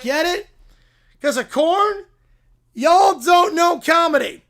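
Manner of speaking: hammed-up corny catchphrase. Farmhand cracking his corn joke, hammy and loud. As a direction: sell the corn pun like a hayseed comic.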